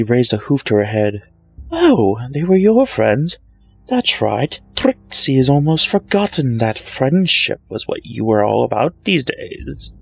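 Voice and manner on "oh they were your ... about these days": high, showy taunting voice; boastful showmare mocking Twilight; smug Trixie. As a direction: gloat over her rival. Trixie mocks.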